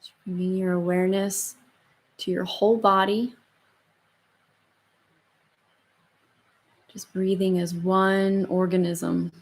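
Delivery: slow, soft meditation voice; calm and unhurried, gently guiding; guided meditation. Acted. Leads the closing meditation.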